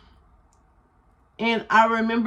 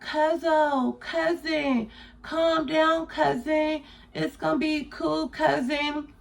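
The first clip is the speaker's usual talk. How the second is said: higher, softer soothing voice imitating the cousin; calming cousin; 7 semitones higher, 3 dB quieter, slower than usual. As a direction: coax her down gently, up high and hushed. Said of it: "her voice was kinda calming me down".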